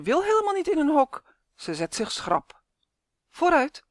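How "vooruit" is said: calling out. Acted. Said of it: "roept de oppasser".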